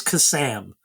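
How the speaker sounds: Kasam with the A of "Sam"; correcting pronunciation. Shown as a demonstration. Co-host correcting how the name is said, corrective pronunciation. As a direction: say Kasam as "kuh-SAM", stressed on the second syllable. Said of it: "am I saying that right?".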